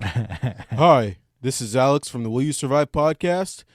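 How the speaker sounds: loud, far-off altered voice; voice changer. Retold or imitated. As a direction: fake voice through a voice changer. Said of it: "recorded myself with my voice changer".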